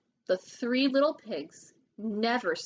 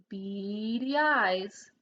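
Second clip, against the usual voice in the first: ordinary delivery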